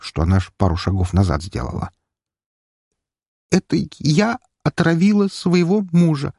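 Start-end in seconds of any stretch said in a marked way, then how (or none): 3.5-6.3 forced through with disgust, higher. A narrator's reading. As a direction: spit the words out in revulsion. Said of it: "процедила она с выражением глубочайшего отвращения". (процедить с отвращением)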